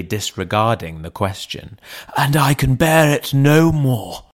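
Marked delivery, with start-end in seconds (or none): 2.2-4.2 lightly voiced butler, a touch higher and strained; a worn-out servant at the end of his tether; desperate butler. A narrator's reading. Butler pleading with the lawyer, strained voice. Poole's repeated plea.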